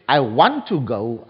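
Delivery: "want" leaned on, held a little long, rest falls away; the desire carries the weight, "want" stressed. Shown as a demonstration. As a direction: press on "want" in "I want to go". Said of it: "the emphasis is on the want".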